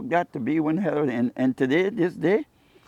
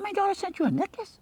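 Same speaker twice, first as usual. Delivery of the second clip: astonished question. Retold or imitated. Retold disbelief.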